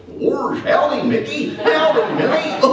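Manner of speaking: high goofy cartoon voice with a laugh in it. Shown as a demonstration. Goofy greeting Mickey and Minnie at work, cartoon voice. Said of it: "I do the voice of Goofy".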